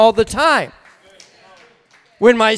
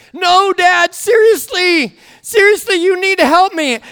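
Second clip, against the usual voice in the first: freaking out; 7 semitones higher than usual. Retold or imitated. Young daughter begging dad for help, panicked. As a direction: mimic a panicky kid insisting on help. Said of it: "she's freaking out".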